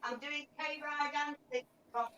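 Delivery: distant voice calling out of sight; called from the next room over; from another room. Real, not acted. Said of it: "she says from the other room".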